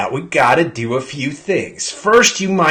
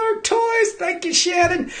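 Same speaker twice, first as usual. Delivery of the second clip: very high, softer squeal of thanks; 3 dB quieter than usual. Real, not acted. Host squealing thanks to sponsor Shannon.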